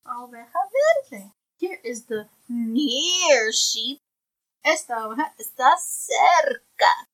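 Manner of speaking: bright, clear picture-book reading; reading to a toddler. Acted. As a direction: read it aloud to a little one. Parent reads picture book aloud.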